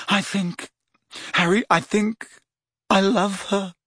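a strangled lovestruck croak. A narrator's reading. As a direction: force the words out choked with love. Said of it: "said Ron in a strangled voice".

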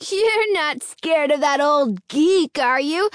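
high, slow challenge; demanding. A narrator's reading. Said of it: "Alan demanded".

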